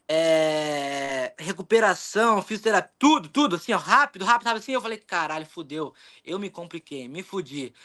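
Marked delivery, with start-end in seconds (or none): 5.0-7.7 low, sinking mock dismay; dropping lower and softer, as if to yourself; dismayed self-talk. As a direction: quietly admit you're in trouble. Retold dismayed thought to himself.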